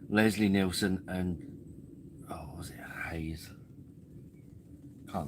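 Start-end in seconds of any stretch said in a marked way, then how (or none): 2.3-3.5 whispered guess. Real, not acted. Trying to recall a name.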